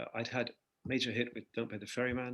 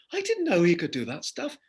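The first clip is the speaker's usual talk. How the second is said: amazed fans; 7 semitones higher, 9 dB louder than usual. Retold or imitated. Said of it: "these guys are going crazy and going".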